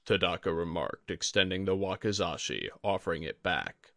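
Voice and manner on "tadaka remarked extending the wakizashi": plain reading voice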